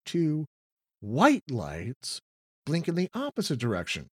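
ordinary talk

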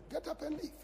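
high, soft urging to go; a sudden high-pitched nudge; inner voice. Pretend. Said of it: "you will feel like".

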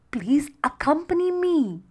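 mild high-pitched plea; pleading. A narrator's reading. Mermaid begs the witch to come along, pleading. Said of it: "she pleaded".

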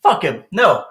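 usual speaking voice

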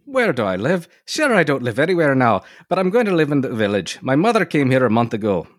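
high-pitched stage-Irish lilt; caricature Irish accent. Acted. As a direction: play up an old-fashioned Irish-American brogue. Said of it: "nineteenth century caricatures of Irish Americans".

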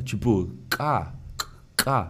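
slow K scraped in the throat; "K" scraped low in the throat. Shown as a demonstration. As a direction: rasp the K from the back of the throat. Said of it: "K raspando a garganta".

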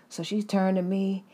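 ordinary talk

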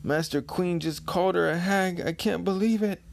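high-pitched awed underling; nervous minion. Acted. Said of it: "the minions are thinking".

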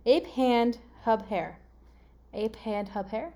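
slow, spaced-out words; deliberate puzzle reading; gibberish readout. Shown as a demonstration. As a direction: read the clue word by word. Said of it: "read you what it says".

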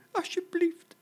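tiny, very high pleading squeak; frightened, squeaky little animal begging; trembling little voice. Acted. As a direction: plead for your life as a little baby zebra. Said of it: "met een bibberend stemmetje".

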